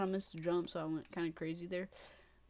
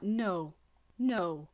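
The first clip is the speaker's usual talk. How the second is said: ordinary delivery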